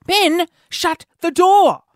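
high, slow re-enacted yell; really loud order. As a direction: call out each word as a startled reprimand. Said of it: "I said that really loudly".

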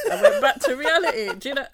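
loud laugh through words, high; laughing loudly. Real, not acted. Laughing about her brain freeze.

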